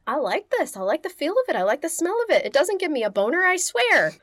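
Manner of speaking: rising, loud creepy excuses; stammering guilty denials. Retold or imitated. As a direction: protest it too much. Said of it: "he was like".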